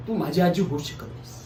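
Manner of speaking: low, restrained bitterness; bitter disowning. Acted. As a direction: say it low, as a grim realisation. Grandson disowns grandmother, first take.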